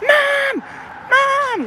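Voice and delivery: put-on very high, wailing child's cry; screaming for mom. Three-year-old calling for mom, mock screaming. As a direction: imitate a little kid shrieking for a parent. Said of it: "screaming for their mom".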